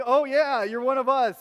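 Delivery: loud, high mock-excited welcome; excited recognition. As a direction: burst out as if spotting one of your own. Acts out two Bostonians recognizing each other.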